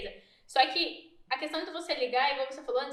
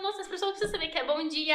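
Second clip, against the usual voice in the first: laughing, higher-pitched enthusiasm; excited; 4 semitones higher than usual. Acted. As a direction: gush it through a laugh. (empolgada, rindo)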